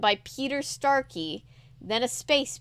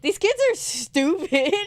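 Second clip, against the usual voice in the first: sneering put-on voice; scornful critic; 6 semitones higher, 4 dB louder than usual. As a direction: dismiss them with a sneer. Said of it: "or it's just like".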